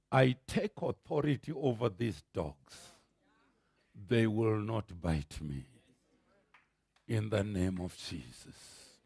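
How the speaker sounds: low, soft, steady authority over the dogs; quiet calm command. Retold or imitated. Preacher retelling his quiet prayer to attacking dogs, hushed and firm.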